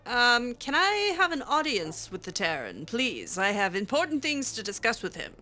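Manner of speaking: ordinary delivery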